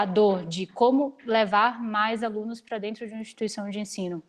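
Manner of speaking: ordinary talk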